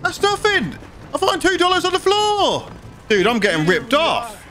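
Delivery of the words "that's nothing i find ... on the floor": much higher, outraged disbelief; incredulous outrage. Real, not acted. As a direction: squeal at the tiny reward like you've been robbed. Outrage at a tiny game reward.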